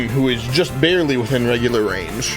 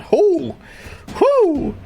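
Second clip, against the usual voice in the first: excited whoop. Real, not acted. GM whooping at damage.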